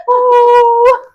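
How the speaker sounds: loud, high gleeful squeal; delighted squeal. Retold or imitated. Little girl squealing at the dog's smile. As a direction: squeal with joy at the dog. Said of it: "and I'd be like".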